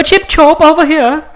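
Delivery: high, loud cackly English witch voice; witch accent. Pretend. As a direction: squeal it out like a storybook English witch. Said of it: "This is my witch accent".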